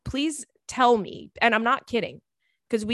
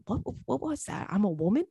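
muttering to herself; 6 dB quieter than usual. Retold or imitated. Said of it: "I went".

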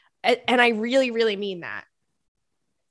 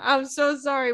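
higher, squeezed out through laughter; laughing apology; 4 semitones higher than usual. Real, not acted. Host apologizes to the listener while laughing, laughing apology. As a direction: apologize while still cracking up.

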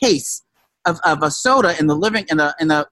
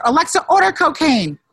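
put-on joking drunk command; 9 semitones higher than usual. Friend retells joking order to Alexa, mock shout. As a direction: call out the order like a joke. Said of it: "I was joking. Said".

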